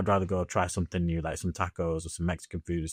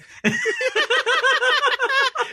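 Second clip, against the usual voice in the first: high laugh; 6 dB louder than usual. Real, not acted. Laughing at the spelling.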